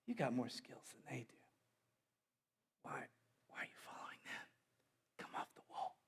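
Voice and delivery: hushed, unvoiced tempting whisper; devil's whisper. Preacher voicing the devil tempting a worker, whispered. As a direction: whisper temptation into their ear. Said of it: "just begin to whisper in your ear".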